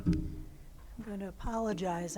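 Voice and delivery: hoarse, raspy voice with little voice left. Real, not acted. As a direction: voice worn rough by smoke. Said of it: "I have no voice because of the smoke".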